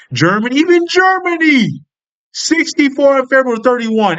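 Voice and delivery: high, incredulous reaction; astonished. Real, not acted. Reading out Germany's poll numbers to viewers, astonished.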